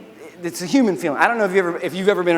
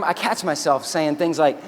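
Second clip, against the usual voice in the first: ordinary delivery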